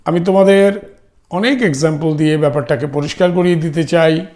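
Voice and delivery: normal talking voice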